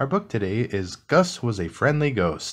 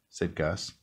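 plain reading voice

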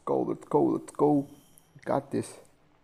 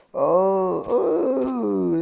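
pained wail; 7 semitones higher, 7 dB louder than usual. Real, not acted. Taking painful hit in game.

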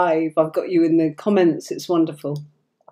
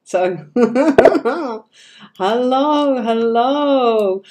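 laughing hello; 5 semitones higher, 4 dB louder, much slower than usual. Real, not acted. Greets unknown viewer, laughing.

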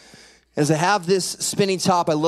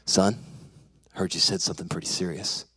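low, grave father's voice; serious dad; 11 semitones lower, 6 dB quieter than usual. Retold or imitated. Dad comes home to talk with son, low and serious. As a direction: lower the voice, softening at the end.

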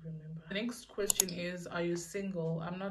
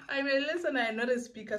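giggly; 8 semitones higher, 7 dB louder, faster than usual. Real, not acted. Giggly burst after laugh.

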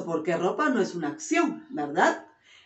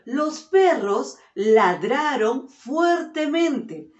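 slow and a little louder, each word given its space; slow clear dictation; 5 semitones higher, 3 dB louder, slower than usual. Shown as a demonstration. Teacher dictating a sentence to her class, slow and clear. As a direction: read it out carefully for the class.

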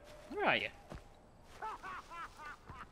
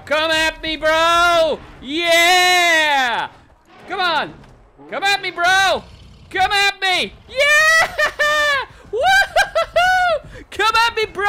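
loud, high, hyped-up challenge; rowdy taunt; 8 semitones higher, 7 dB louder, slower than usual. Pretend. Player daring enemies to fight, rowdy shouted taunts. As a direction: egg them on, whooping and laughing.

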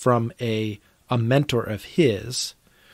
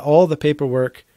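normal talking voice

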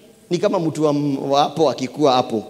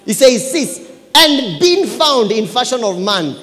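loud, high scripture proclamation; full-force preaching; 7 semitones higher, 7 dB louder than usual. Real, not acted. Preacher gives church the reason from scripture, full-force preaching. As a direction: proclaim the verse with mounting force.

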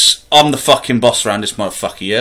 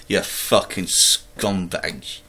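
muttered insult; 6 semitones lower, 9 dB quieter, much slower than usual. Acted. Muttered insult after laugh.

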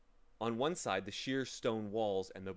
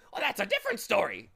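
higher, louder, fast and friendlier bird; a bit more amiable; 11 semitones higher, 10 dB louder, faster than usual. Acted. Bird turning friendlier, fast and amiable. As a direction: brighten up as if pleased by the offer. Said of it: "a bit more amiably".

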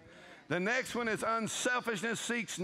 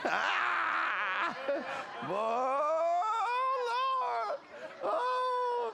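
shouted cry; 12 semitones higher, much slower than usual. Real, not acted. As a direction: cry out to heaven. Preacher cries out in delight.

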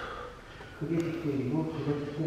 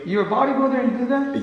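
loud, slow, stunned question; can't believe what he's hearing; shocked disbelief; 7 semitones higher, 8 dB louder, much slower than usual. Real, not acted. Shocked by the workout plan.

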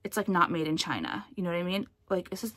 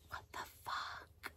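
breathless unvoiced whisper, slow; shocked whisper; 20 dB quieter, slower than usual. Real, not acted. Vlogger reads another China label, stunned whisper. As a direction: mouth it in disbelief.

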